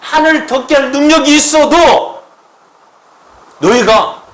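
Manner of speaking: loud, high-pitched impassioned preaching; full-force preaching. Acted. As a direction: build it up and proclaim it with full force. Preaching climax. (높고 크게 외치는 설교)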